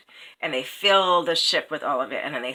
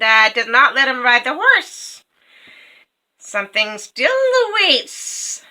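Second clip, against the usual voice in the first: high, loud, slow raven cackle-voice; gloating raven; 8 semitones higher, 11 dB louder than usual. A narrator's reading. Second raven boasting the fire curse, high and loud. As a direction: shriek out the curse with glee. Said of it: "the second raven says".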